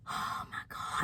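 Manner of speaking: a broken whispered oh my God while crying; tearful whisper. Real, not acted. Vlogger crying on camera, whispering through the tears. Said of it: "I'm not supposed to be crying".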